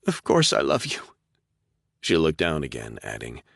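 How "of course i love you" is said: raised, strained, fighting emotion; young woman struggling not to cry; holding back tears. Acted. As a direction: force it out past the tears. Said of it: "fought to control her emotions".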